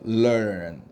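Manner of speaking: LEARN with 럴 running smoothly into 언; linked in one go. Shown as a demonstration. Teacher shows students how to link it, learn in one go. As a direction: join 럴 and 언 into one drawn-out learn. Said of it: "이어서 발음한다고 생각하면 돼요".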